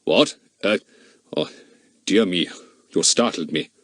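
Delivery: put-on startled man with an Austrian accent; deep-voiced Austrian, caught off guard. A narrator's reading. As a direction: stammer it mildly in an Austrian accent. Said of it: "said the man in a very deep voice, with a decidedly Austrian accent".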